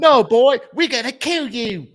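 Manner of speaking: high, loud cartoonish threat; hammed-up villain turning on the boy; mock cruel relative. Relatives threaten the orphan boy, mock menacing.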